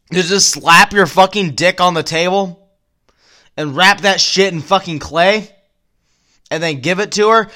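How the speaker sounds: usual speaking voice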